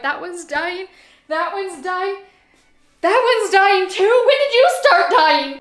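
building from high to very high and loud in mock panic; getting worked up. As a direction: spiral into hammed-up despair over each plant. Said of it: "I'm getting worked up".

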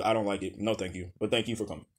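ordinary delivery

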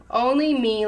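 plain reading voice

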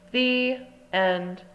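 usual speaking voice

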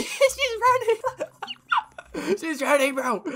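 squealing high laughter through the words; real high-pitched laughing fit. Real, not acted. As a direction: crack up as she runs away. Laughing as player flees.

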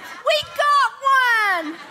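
triumphant "we got one"; jubilant cheer. Retold or imitated. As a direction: celebrate like you just scored. Pastor celebrates the healing.

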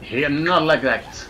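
put-on comic French accent all through the race. Racing in Mario Kart, talking to viewers, in a comic French accent. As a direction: play it as a cheeky Frenchman racing.

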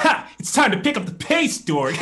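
high, loud, cocky cartoon hero; Sonic. Player quipping at Dorg on request, high loud cartoon voice. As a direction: shout it high like a cartoon speedster. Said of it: "say that in a Sonic voice".

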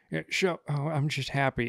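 soft meek imitation. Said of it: "Joe Pera would be".

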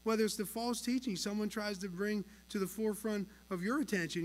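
usual speaking voice

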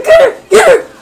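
loud, high yelling at the screen; excited shout. Real, not acted. Gamer cheering her fighter on, loud high shout. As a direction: urge your fighter on at full voice.

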